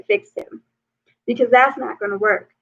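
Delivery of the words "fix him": demanding client. Retold or imitated. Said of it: "go to a counselor and say".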